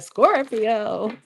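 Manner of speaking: mock cracked voice on "Scorpio"; "Scorpio" with the voice breaking, half-joking; imitating voice crack. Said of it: "My, my voice just cracked. Like".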